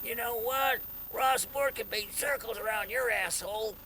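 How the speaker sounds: high, loud echo of the boast; Ross Ward yelling. Retold or imitated. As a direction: replay the taunt at full volume. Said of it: "all I could hear in my voice".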